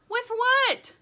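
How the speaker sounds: high, loud incredulous question; shouted question. A narrator's reading. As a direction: yell it back over the wind. Poppy asks in storm.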